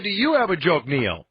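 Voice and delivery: YOU stressed, pitch falling away on the name; clarifying question with YOU punched. Real, not acted. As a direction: lean on YOU to clear up who is being asked. Repeats a misheard question to Neil.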